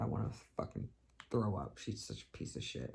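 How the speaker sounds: whispered muttering disgust. Real, not acted. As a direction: hiss it under your breath.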